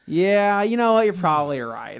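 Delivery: loud, high mock agreement; a sarcastic "sure, you're right". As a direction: pretend to give in, big and loud. Retold sarcastic reply to uncle.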